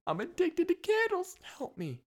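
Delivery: squeaky high mock cry, then a small plea; mock addict plea. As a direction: play a hammed-up addict begging for help. Mock confession of candle addict.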